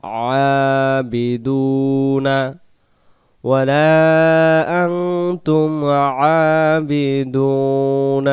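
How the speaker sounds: slow melodic tajweed chant; Quran recitation. Shown as a demonstration. Teacher reciting the verse for students, slow chant. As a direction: recite slowly, stretching each vowel.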